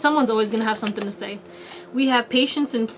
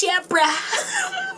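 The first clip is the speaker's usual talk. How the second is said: higher, laughing "Yeah, bruh"; a giggly, incredulous "bruh"; 9 semitones higher than usual. Pretend. Repeated with a laugh.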